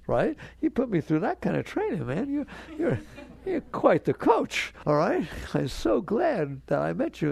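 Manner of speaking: a beaming, half-joking thank-you; mock grateful speech. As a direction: thank the ex-husband like a coach, laughing. Thanking the ex-husband as coach.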